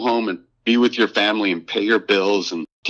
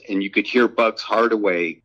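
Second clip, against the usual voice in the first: normal talking voice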